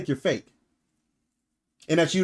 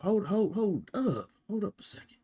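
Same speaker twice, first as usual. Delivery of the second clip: fading mutter; 3 semitones higher, 7 dB quieter than usual. Real, not acted. Trails off while checking something.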